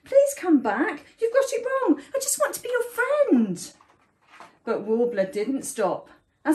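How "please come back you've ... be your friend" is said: high, earnest storybook plea; high-pitched begging dragon; pleading. A narrator's reading. Dragon begs bird to return.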